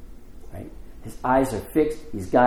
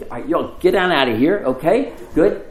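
loud, higher-pitched order to clear out; brisk send-off; 5 semitones higher, 6 dB louder than usual. Retold or imitated. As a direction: shoo them off, raised voice. Acts out Jesus shooing disciples off.

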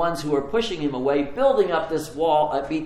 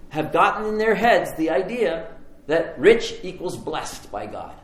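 ordinary talk

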